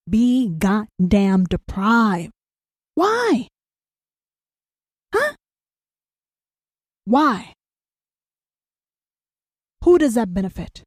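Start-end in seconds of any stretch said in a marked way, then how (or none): 3.0-7.5 high challenging why. Real, not acted. Rhetorical challenge.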